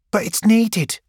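put-on insist, as the young guide; young woman protesting. Gang girl insisting the ammunition is needed, earnest.

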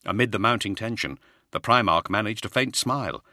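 usual speaking voice

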